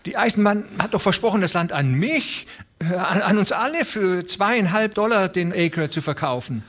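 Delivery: stammelnd, hastig; stammering old man. A narrator's reading. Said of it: "stammelte der alte Broderson".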